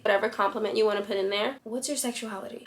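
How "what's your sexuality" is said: soft, understated asking; low-key flirty question. Shown as a demonstration. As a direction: ask her softly, no upswing. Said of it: "Which one did you find more appealing".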